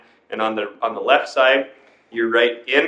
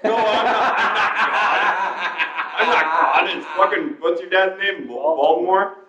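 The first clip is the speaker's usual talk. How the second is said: laughing impression of dad; 5 semitones higher, slower than usual. Mimics dad denying being God.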